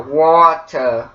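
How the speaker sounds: British-accent "waw-tuh" with hard T. Shown as a demonstration. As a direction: drop the R in a British Water. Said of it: "you don't say the R at all".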